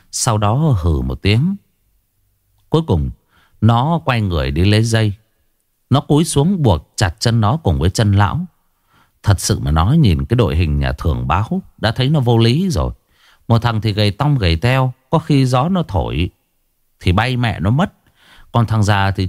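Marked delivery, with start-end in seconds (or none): none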